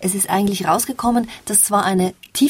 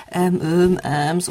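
demonstrating filler ums; string out a few ums as examples; hesitation sounds; slower than usual. Shown as a demonstration. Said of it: "wie er Verzögerungslaute setzt".

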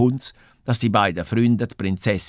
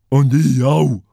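quick call; 3 semitones higher, 6 dB louder, slower than usual. A narrator's reading. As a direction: bear calling out, louder. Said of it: "ruft der Brummbär schnell". (laut dazwischengerufen)

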